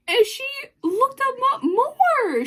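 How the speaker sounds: high, drawn-out fangirl squeal; slow, high-pitched gleeful exclamation; thrilled disbelief. Real, not acted. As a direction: stretch it out high in astonishment. Thrilled friend is getting hooked.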